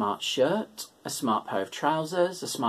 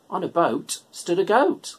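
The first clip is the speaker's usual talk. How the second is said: ordinary delivery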